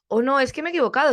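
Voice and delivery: mock a pupil's fretting over a mistake; alumno apurado. (imitación rápida y aguda de alumno apurado)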